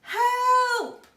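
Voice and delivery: high, very loud cry for help; loud, high panicked cry; shout for help. Acted. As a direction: scream it from the sky. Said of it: "there was a shout".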